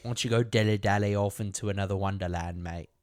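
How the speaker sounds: fast, mangled mock British jibe; bad British-Australian accent. Brushing off co-host, bad British-Australian accent. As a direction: rattle it off in a hammed-up British accent. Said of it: "England moved to Australia and then had their voice box replaced with a blender".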